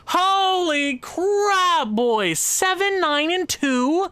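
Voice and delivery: high, drawn-out disbelief; shocked exclamation. Real, not acted. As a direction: gape at the record in horror. Shock at bad record.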